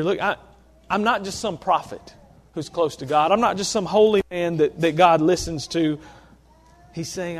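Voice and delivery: ordinary delivery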